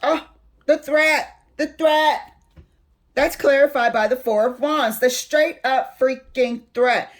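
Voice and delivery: rising real irritation at the cards; getting pissed off. Real, not acted. Reader fired up over the threat card, angry. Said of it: "I'm getting pissed off".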